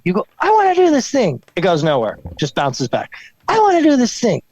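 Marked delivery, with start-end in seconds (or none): 0.4-1.4 high-pitched mock eager declaration; eager wish. Wish launched, bounces back. 3.5-4.4 high-pitched mock eager declaration; eager wish. Wish launched again, bounces back.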